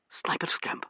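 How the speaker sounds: breathy unvoiced question; hushed startled echo. Startled man echoing Joe's words, hushed. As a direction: echo it back under your breath, startled.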